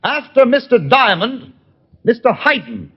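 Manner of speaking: projected commanding call; calling orders. Acted. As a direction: call the targets out to a man far away. Giving orders to distant gunman.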